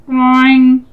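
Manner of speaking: loud, slow imitated clang; drawn-out boing. A narrator's reading. Visor sound as Grover hits, drawn-out boing. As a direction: stretch out the noise like a spring.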